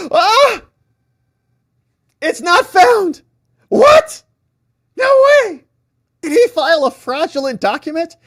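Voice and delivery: high, loud, shrieking disbelief; shocked shriek. Retold or imitated. Reading a broken link, he shrieks in hammed-up shock. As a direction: squeal in mock alarm like a page just vanished.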